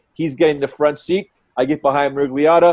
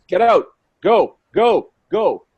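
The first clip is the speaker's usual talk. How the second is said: louder, drawn-out commands; urgent order; 6 semitones higher, 5 dB louder, slower than usual. Retold or imitated. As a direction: wave them on with slow, raised-voice orders. Cop ordering the driver off.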